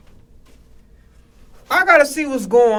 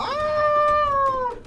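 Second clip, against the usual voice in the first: very high, long drawn-out scream. Real, not acted. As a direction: hold one piercingly high "Ah". Yelling in pain.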